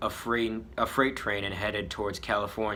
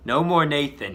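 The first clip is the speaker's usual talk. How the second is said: louder, higher angry shout; yelling; 6 semitones higher, 9 dB louder than usual. Acted. Smog rejecting his old name, louder yell. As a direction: snap back at the old name. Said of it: "he yells".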